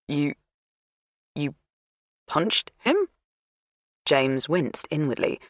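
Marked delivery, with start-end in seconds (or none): 0.1-3.0 incredulous. Acted. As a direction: pitch rising in disbelief. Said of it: "his voice rising in pitch with incredulity".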